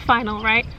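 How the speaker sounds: usual speaking voice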